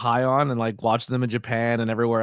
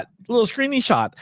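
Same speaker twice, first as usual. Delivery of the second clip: put-on much higher, playful baby talk; cutesy; 11 semitones higher than usual. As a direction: say it sweet and silly. Cutesy rewording of screenshot.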